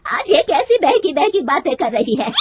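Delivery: mother-in-law scolding her daughter-in-law, loud and angry. Acted. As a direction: rebuke her sharply and loud. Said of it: "गुस्से में कहती है".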